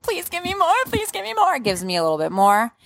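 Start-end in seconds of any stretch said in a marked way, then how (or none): 0.0-1.6 high, begging plea; pleading. Retold or imitated. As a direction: beg for more, voice pitched way up. Begging during labor, retold.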